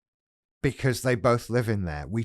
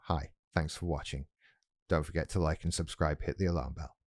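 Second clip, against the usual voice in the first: rattled-off softer YouTube outro; softer mock outro; quiet aside; 4 semitones lower, 6 dB quieter than usual. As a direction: mumble the outro quickly. Throwaway YouTuber sign-off aside.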